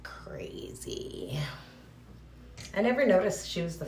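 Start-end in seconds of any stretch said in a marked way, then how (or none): 0.0-1.4 breathless low whisper. Retold or imitated. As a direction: whisper it in awe. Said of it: "I was like".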